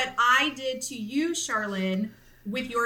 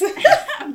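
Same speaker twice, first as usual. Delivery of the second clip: high, loud burst of laughing; burst of laughter; 9 dB louder than usual. Real, not acted. Mother laughing at her own joke about her son, high and loud. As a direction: let out a real burst of laughter.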